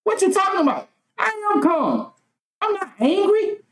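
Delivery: insistent, high-pitched denial of anger; protesting yell. Retold or imitated. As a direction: protest that you are perfectly calm. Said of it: "But because I'm yelling".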